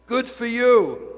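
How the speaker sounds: slow, loud, beaming good for you; emphatic praise. Retold or imitated. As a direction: really lean into the praise. Marvelling at a very late child.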